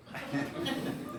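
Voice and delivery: breathy, high laugh of disbelief; airy astonished giggle; disbelieving laugh. Real, not acted. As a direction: laugh at how right she was. Prediction comes true.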